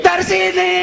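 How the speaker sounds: sudden very high call; hammed-up cry at death's door; acted panicked cry. Acts out cry at death's visit.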